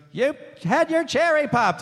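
imitated audience member. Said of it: "And she goes".

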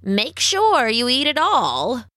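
slow, swooping witch command; old witch. A narrator's reading. Witch orders her captive to eat, slow and witchy. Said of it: "said the witch".

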